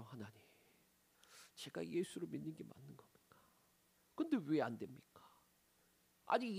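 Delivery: hushed, breathy plea to God; whispered prayer. Retold or imitated. Believer pleading with God in a despairing whisper. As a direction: whisper the complaint as if praying alone.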